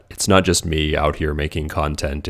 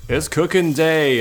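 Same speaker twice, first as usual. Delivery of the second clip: excited announcement; 10 semitones higher, slower than usual. Real, not acted. Announcing the cooking day.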